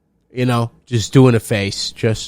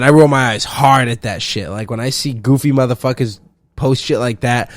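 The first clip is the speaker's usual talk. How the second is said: ordinary talk, nothing marked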